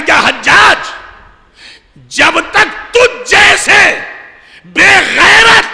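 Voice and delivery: put-on young man shouting defiance at Hajjaj; defiant challenge. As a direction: hurl it at the tyrant Hajjaj like a challenge. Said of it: "للکار کے یہ علی کا شیعہ کہہ رہا ہے".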